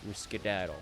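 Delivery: ordinary talk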